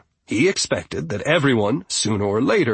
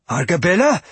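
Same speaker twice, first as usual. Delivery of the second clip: louder, higher call of a name; worried call; 7 semitones higher, 5 dB louder than usual. Acted. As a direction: call her name in alarm. Calling unconscious friend's name.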